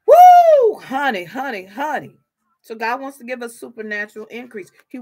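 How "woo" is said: loud, high "woo!"; sudden high holler; excited whoop. Real, not acted. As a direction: let out a piercing preacher's whoop. Preacher's exclamation.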